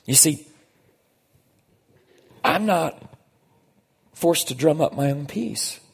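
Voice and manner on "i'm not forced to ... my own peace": normal talking voice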